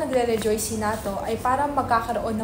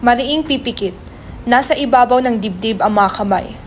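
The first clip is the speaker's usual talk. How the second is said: louder, even stage-direction read; 5 dB louder than usual. Acted. Narrator reading stage directions to the audience, even and loud.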